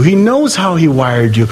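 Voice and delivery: ordinary delivery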